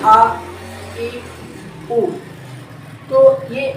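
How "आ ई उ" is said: आ, ई, उ each sounded apart with a throat catch; drilling vowels for a class; vowel demo. Shown as a demonstration. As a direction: sound out आ ई उ distinctly. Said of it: "जैसे हमज़ा ज़बर आ".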